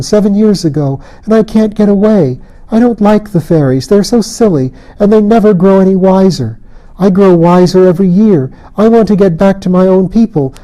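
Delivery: put-on a lonely stolen girl begging to go home; changeling girl. A narrator's reading. Changeling girl begs Colin to take her, wistful plea.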